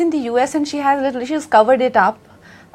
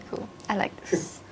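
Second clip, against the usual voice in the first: ordinary talk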